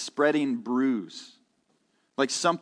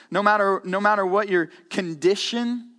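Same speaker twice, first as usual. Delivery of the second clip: ordinary talk, nothing marked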